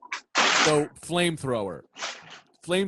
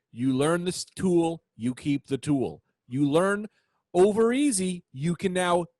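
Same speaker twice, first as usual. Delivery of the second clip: slow, weighty ad slogan; slow commercial slogan; 3 semitones higher, slower than usual. Retold or imitated. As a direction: read it like a slow TV ad. Quoting the DeVry commercial.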